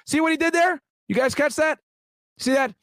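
high, eager call-out to the viewers; excited gotcha. Real, not acted. Ex-agent spots the defense lawyer's trick, excited high call-out.

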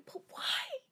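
very high, dwindling mock plea. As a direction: squeak it in exaggerated dismay. Listener mock-pleads in reaction.

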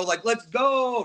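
let's go; hype cheer. Shown as a demonstration. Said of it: "Not like to hurry you up".

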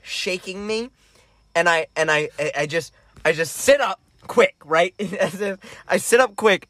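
usual speaking voice

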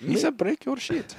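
high goofy alien voice; mock Jar Jar. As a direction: squeak the threat like Jar Jar. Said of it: "Why do you talk like that".

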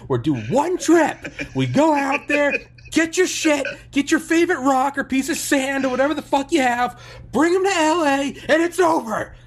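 high, loud screaming rant as Sam Kinison; Sam Kinison shout. Retold or imitated. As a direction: scream it like an unhinged comic. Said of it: "he goes".

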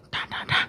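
hushed, voiceless knocking noise; imitated door knock. Storyteller imitating knocks on parents' door at night, hushed imitation. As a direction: imitate pounding on a door under your breath. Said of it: "and I hear".

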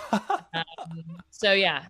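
ordinary delivery

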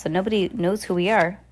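ordinary delivery

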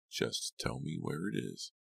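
slow, soft cold demand; cold, slow, soft threat; quiet menacing villain. A narrator's reading. Farberson voiced, slow and soft.

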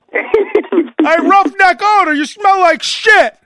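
loud, high mock-bully jeer; taunting bully. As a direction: holler it down the hall to tease him. Acting out bullies taunting Odor.

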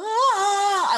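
high, loud mock wail; overdone fake sob; imitated crying wail. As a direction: ham up a crybaby wail. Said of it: "Betty's crying because Betty cries".

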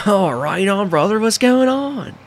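an excited surfer-bro greeting, up high; Todd's voice. Acted. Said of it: "I have to do Todd's voice".

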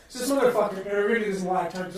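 ordinary talk, nothing marked

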